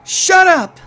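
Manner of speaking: shouting. Acted. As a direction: scream it at him. Girl master shouts at servant.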